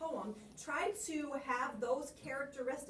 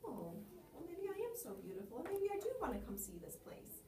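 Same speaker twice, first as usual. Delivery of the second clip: soft, higher, swayed and dreamy; fly giving in; 5 semitones higher, 6 dB quieter than usual. Shown as a demonstration. Fly talked into visiting the parlor, soft and rising. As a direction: let yourself be won over. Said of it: "show him being very afraid at the beginning, and then".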